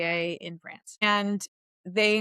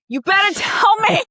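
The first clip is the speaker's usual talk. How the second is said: high, loud mock yell with a laugh; 12 semitones higher, 9 dB louder than usual. Stand-up teacher imitating yelling at the audience, mock yell. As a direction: bark a mock demand at the class. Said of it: "He was like".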